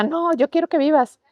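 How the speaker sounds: high, imploring plea; mother's pleading. Retold or imitated. Mother to child in pain, high imploring plea. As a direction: plead with your sick child to stay alive. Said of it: "y mi mamá".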